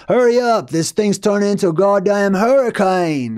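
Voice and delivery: put-on high, urgent Australian accent. Movie character fleeing a hurricane, urgent Australian accent. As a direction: slip into a hammed-up Australian urging haste. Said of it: "now I'm going into Australian".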